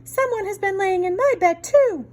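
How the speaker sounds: mama bear, high and aggrieved; middle-sized voice. Acted. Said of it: "in her middle-sized voice".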